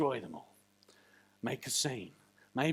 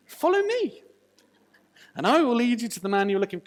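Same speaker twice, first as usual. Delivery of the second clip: mock cheerful guide; 7 semitones higher, 7 dB louder than usual. Elijah leading blind army.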